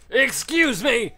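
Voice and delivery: "Excuse me!" yelled high and slow, dragged out; loud high excuse me. Real, not acted. Gamer yelling at a monster, loud high excuse me. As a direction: shout "Excuse me!" in a put-on high voice.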